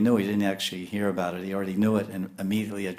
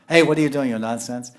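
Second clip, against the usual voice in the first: loud, higher teasing rebuke; mock scolding; 3 semitones higher, 6 dB louder than usual. An Indian elder telling someone off, loud mock scolding. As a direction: call out the fool, half in jest. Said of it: "In India they say".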